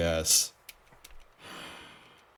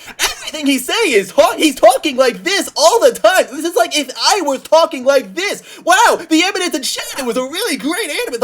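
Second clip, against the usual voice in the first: much higher, mimicking the narrator's voice; mocking high-pitched voice; 13 semitones higher, 6 dB louder than usual. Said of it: "talking in this really high-pitched voice".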